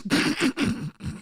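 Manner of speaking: soft high-pitched giggle; a quiet, breathy laugh to himself. Real, not acted. Amused at his own rant, a soft high giggle.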